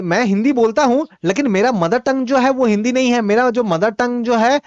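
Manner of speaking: usual speaking voice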